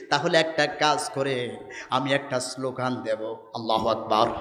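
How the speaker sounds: low, soft quoted speech; quiet proposal. Retold or imitated. Preacher voices Umar offering a slogan, hushed and low.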